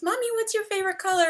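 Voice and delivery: put-on high, fast little-kid voice; child asking mom. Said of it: "I always feel weird telling my kids".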